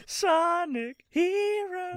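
high, very slow mock title call; drawn-out high announcer. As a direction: stretch it out high like a game title.

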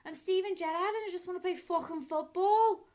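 put-on high-pitched, thick Scouse accent; Steven Gerrard. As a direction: burst out in exaggerated Liverpudlian. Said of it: "one thing in a Scouse accent".

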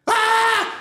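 high hammed-up child's wail; mock kid wail. Kid looks at his daddy and wails, high mock cry. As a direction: wail like a little boy to his dad.

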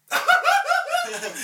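embarrassed nervous laugh. Retold or imitated. Re-enacting his laugh at the girl in the store, high awkward cackle. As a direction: re-enact a mortifying burst of anxious laughter. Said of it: "I laugh just like that".

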